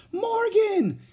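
very high, loud glad cry of a name; joyful cry. A narrator's reading. Annie crying out happily on seeing Morgan again, high and loud. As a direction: cry out in delight at a friend's return. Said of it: "cried Annie".

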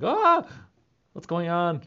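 sudden high yelp, then a jumpy question; jolted awake by the barking dog; startled wake-up. Retold or imitated. Startled by her barking dog at night, yelping awake. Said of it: "I'm like".

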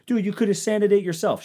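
high, snooty mock of an advice-giver; mocking know-it-all. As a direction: ape the smug helper, way up high. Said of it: "if I would have paid somebody".

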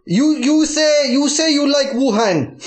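stammered, hammed-up Chinese accent; mock Chinese accent. Captor tells hostage what to say, mock Chinese accent. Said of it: "these fucking voices are more".

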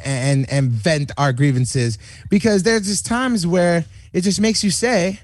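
ordinary talk, nothing marked

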